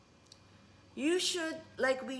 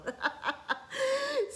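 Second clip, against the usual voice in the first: high laugh. Real, not acted. Laughing over mom's words.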